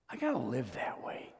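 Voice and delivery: hushed whisper to the room; whispered resolve. Real, not acted. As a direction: drop to a breathy hush. Sermon point whispered.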